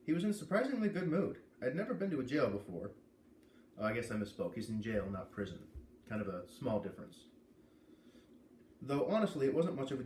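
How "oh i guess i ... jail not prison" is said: usual speaking voice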